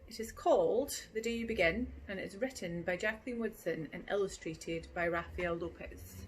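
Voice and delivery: ordinary delivery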